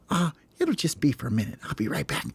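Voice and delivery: soft, breathy aside; Hope brushing off. Acted. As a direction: whisper it, already walking away. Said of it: "He's just like".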